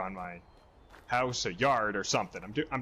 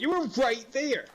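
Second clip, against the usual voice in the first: exasperated disbelief; 12 semitones higher than usual. Retold or imitated. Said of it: "I'm like".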